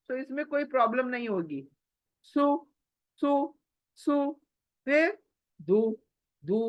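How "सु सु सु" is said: सु heavy with rounded lips; thick su. Shown as a demonstration. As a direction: round the mouth for a full thick सु. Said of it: "मोटा सु, मुंह गोल".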